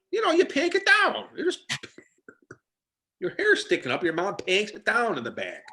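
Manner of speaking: high-pitched parent explaining the obvious; mock fussing parent. Explaining panking down hair for photo day, mock fussing parent. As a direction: put on a high, nagging-parent voice.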